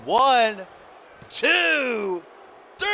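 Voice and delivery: high rising shouted count; excited count. Real, not acted. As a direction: count the pin with rising tension. Calling the pin count.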